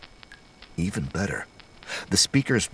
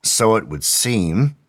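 drawn-out dry reply, pitched up; 5 semitones higher, 5 dB louder, slower than usual. A narrator's reading. McBride answering about someone's diary, dry. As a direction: agree slowly and dryly. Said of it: "McBride said dryly".

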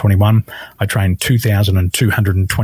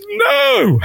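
crying no. Retold or imitated. Client crying in regression.

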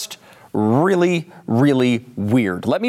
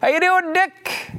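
high-pitched, drawn-out hail; calling out a greeting; 13 semitones higher, much slower than usual. Acted. Time traveler walks up to Dick Cheney, exaggerated high greeting. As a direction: hail him in a much higher voice.

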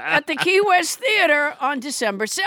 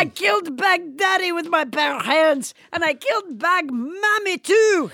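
put-on French accent; 4 semitones higher than usual. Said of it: "French Trump".

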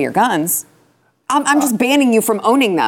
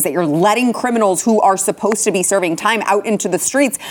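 ordinary talk

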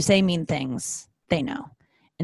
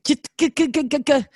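mock shooing; 8 semitones higher, 3 dB louder than usual. Retold recoil from ex's touch.

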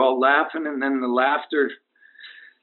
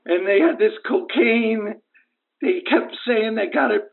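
high-pitched, drawn-out lament; distraught; 7 semitones higher than usual. Acted. Injured caller telling host about his team, distraught. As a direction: lament the betrayal in halting, higher phrases.

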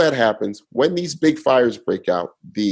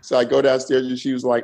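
ordinary delivery

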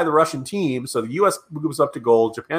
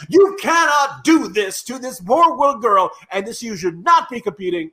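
Stephen A. Smith impression; 9 semitones higher, 3 dB louder than usual. Podcaster mimicking skating commentators' outrage, loud high pundit shout. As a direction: bellow it like a TV sports hothead. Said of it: "because I love to imitate Stephen A. Smith".

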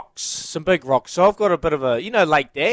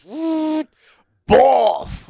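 whoop and boff; 11 semitones higher than usual. Retold or imitated. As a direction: mimic the rocks crashing onto the trailer. Imitating rocks dropping.

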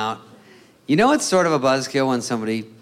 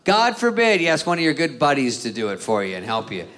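ordinary talk

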